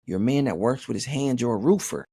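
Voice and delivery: normal talking voice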